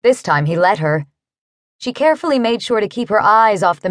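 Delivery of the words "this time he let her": plain reading voice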